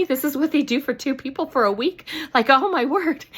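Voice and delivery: overflowing with amazement; amazed. Retold or imitated. To herself at the car, high and amazed. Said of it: "I was so excited".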